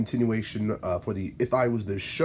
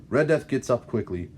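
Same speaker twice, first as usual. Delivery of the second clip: ordinary delivery, nothing marked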